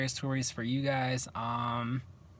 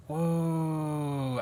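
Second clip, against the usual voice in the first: long drawn-out mild whoa; stretched, slightly raised reaction of amazement; retold amazement; 3 semitones higher than usual. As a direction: draw it out in retold wonder. Retold reaction to rising cup.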